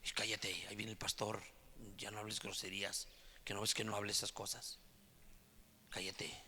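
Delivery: low, very soft aside; imitated hushed warning. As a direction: hush someone urgently under your voice. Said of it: "escucho que dicen". (advertencia en voz baja, imitando a quien calla a otro)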